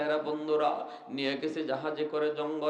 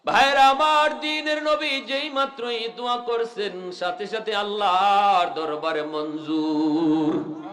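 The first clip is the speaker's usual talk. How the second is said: high, loud, chant-like preaching, settling as it ends; impassioned preaching; 5 semitones higher, 5 dB louder than usual. Acted. Preacher telling brothers the prayer was accepted, impassioned chant. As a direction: sing out the sermon, then come down.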